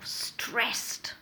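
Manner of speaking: breathy, drained "Stressed."; weary whisper. Shown as a demonstration. Teacher modelling a stressed answer, worn-out whisper. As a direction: let it out like a tired sigh. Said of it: "try using some of the following".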